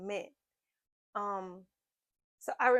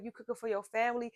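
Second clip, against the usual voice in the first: ordinary talk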